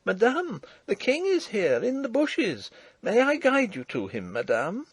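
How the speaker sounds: put-on high, eager young peasant girl; a breathless country girl bursting with news; excited farm girl. Farm girl reports to princess.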